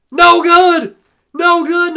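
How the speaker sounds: loud high shout; bursting no good; excited call. Real, not acted. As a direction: announce the miss with a roar. Missed field goal called.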